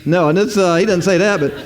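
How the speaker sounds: ordinary talk, nothing marked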